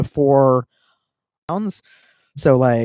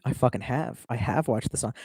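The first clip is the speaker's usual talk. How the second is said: ordinary delivery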